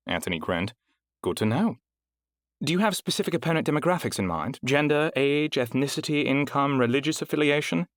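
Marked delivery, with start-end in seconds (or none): 1.2-7.9 high, smooth clerk voice running through a checklist; efficient, courteous registrar; polished receptionist. A narrator's reading. Heidi voiced in narration.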